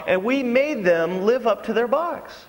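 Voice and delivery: ordinary delivery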